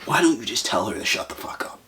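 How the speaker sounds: a soft, voiceless whisper under the breath; whispered taunt. Retold or imitated. Whispering a taunt about the girl's weak boyfriend. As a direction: hiss the dare so only he hears. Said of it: "And I'm like".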